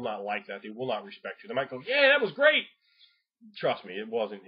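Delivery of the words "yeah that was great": high, loud mock enthusiasm; sarcastic squeal of praise; fake praise. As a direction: overdo the fake cheer. Player faking praise.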